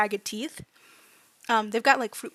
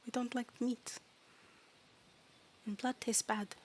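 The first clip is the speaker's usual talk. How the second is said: soft, halting fairy voice; quiet little fairy explaining its tastes; matter-of-fact fairy; 7 dB quieter than usual. Acted. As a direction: state it simply with long hesitations.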